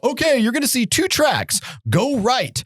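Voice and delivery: high-pitched, emphatic instructions; retold urgent directions; urgent warning. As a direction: imitate warning a friend over the phone. Warning friend by phone.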